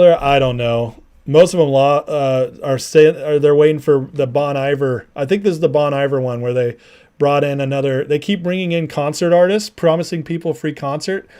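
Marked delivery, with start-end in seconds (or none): none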